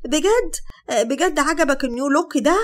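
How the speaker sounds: high, thrilled, fishing for praise; twirling happily as she asks; delighted. A narrator's reading. Sara spins round asking Hatem about her look, high and thrilled. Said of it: "ضحكت وقالت وهي بتلف حوالين نفسها بفرحة".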